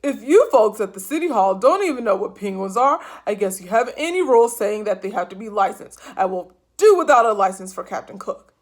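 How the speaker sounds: loud, indignant rant; outraged. A narrator's reading. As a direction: let the outrage pour out. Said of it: "completely outraged".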